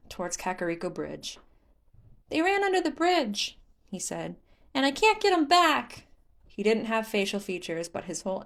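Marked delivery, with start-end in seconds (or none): 2.3-3.6 high, loud, sulky spirit voice; tattling like a hurt child; pouting Korok. Acted. Hestu pouts about monsters. 4.7-6.1 high, loud, sulky spirit voice; tattling like a hurt child; pouting Korok. Acted. Hestu pouts.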